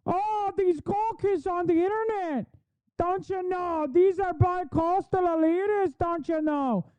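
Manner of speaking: mocking folksy Midwest yokel voice. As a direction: sneer at her as a put-on country bumpkin. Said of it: "really leans into it".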